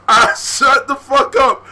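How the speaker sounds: ordinary talk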